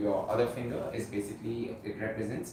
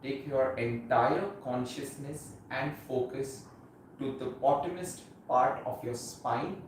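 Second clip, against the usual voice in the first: guided meditation; 4 dB quieter than usual. Acted. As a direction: ease them gently into stillness, unhurried. Leads guided meditation practice.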